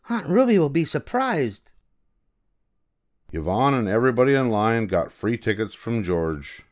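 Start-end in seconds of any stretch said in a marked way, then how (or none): 0.1-1.7 put-on high girlish voice, quickening question; eager child counting her dollar; little girl. Yvonne speaks.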